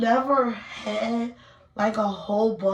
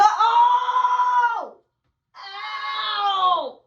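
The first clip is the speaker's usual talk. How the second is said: pained cry; 11 semitones higher than usual. Real, not acted. Yelping in pain as she rips the wax off. Pain while waxing armpit.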